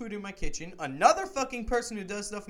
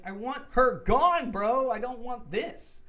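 frustrated outburst; 8 semitones higher, 8 dB louder, slower than usual. Retold or imitated.

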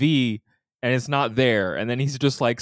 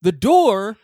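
building to a high, loud mock scream; slow down and shriek the last words in exasperation; hammed-up sitcom outburst, high and loud; Jerry scream; 13 semitones higher, 8 dB louder, slower than usual. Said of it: "it's a primo Jerry scream".

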